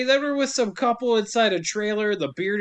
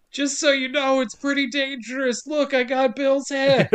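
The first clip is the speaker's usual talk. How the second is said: mock announcement; 6 semitones higher than usual. Sheriff announcing over car speaker.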